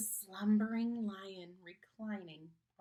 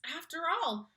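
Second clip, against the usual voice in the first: usual speaking voice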